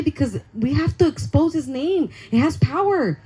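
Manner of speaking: usual speaking voice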